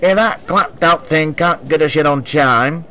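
lightly voiced grumpy old man; elderly passenger complaining. A narrator's reading. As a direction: grumble like an old gent. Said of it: "finished an elderly man".

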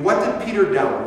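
usual speaking voice

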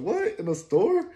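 sudden high, loud "What?" in disbelief; can't believe she means in the store; shocked disbelief. Retold or imitated.

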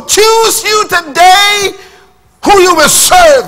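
very high, very slow, loud proclamation; commanding shout. Retold or imitated. Joshua addressing three million people, high slow shout. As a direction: shout it across a vast crowd. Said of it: "he says".

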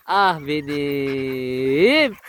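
very slow stretched sigh; fond drawn-out sigh. Real, not acted. Owner sighing fondly at her pet, very slow. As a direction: sigh lovingly over the dog.

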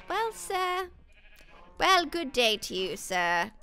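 mock gentleman. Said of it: "And I said".

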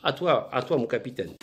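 ordinary talk, nothing marked

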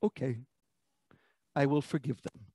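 low, soft, acted reply; quiet relenting. God answering Amos's plea with forgiveness, quietly relenting. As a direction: give in quietly, dropping low. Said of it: "And God says".

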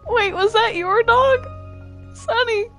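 ordinary talk

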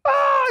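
very high, loud mock scream; short piercing retold shriek; imitated scream. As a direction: scream like startled passengers. Acts out the others screaming.

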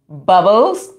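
usual speaking voice

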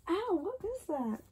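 usual speaking voice

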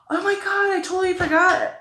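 high, loud oh-my-God realization; sudden surprise. Real, not acted. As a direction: blurt it out as it hits you. Suddenly remembers a skipped product.

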